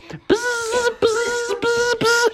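very high buzzing clipper sound. Acted. Billy Goat shaves his wool, high clipper buzz. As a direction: imitate electric shears buzzing. Clipper shaving noise imitated.

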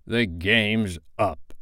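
slow, drawn-out captain's lament; stretched, despairing pronouncement; passionate. A narrator's reading. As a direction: declare defeat with heavy drama. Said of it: "he spoke passionately".

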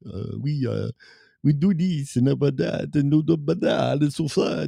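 muddled fake French patter; mock French accent. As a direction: imitate a garbled French video reviewer. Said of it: "how's the French accent go".

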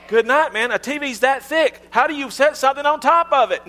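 put-on high, incredulous young person; mock millennial disbelief. Preacher mocks millennials doubting big old TVs, high incredulous voice. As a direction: play a baffled millennial, way up in pitch. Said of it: "some of you millennials are like".

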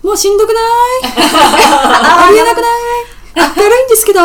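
high, loud bubbly gyaru complaint; Heisei gyaru voice. Shown as a demonstration. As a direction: whine it like a Heisei gal. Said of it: "平成のギャル風に言うと".

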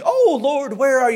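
high, loud cry of Oh, Lord; distressed cry. Retold or imitated. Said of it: "another trial that happens".

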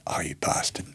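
hushed, voiceless oh you bastard; breathy dawning surprise; whispered realization. Retold or imitated. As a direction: let the penny drop in a whisper.

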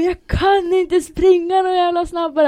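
high, drawn-out furious yell; loud, exasperated retort, every word stretched; angry protest. Retold or imitated. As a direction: shout it in acted desperation. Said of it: "Jag var så himla arg".

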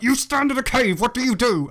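high-pitched aggressive yell in mock confrontation; hammed-up aggressive game master. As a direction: bellow it like you're accosting strangers. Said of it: "like, aggressively in the street".

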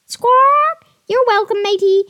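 high, loud squawky parrot. Acted. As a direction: answer like a proud parrot. Parrot replies.

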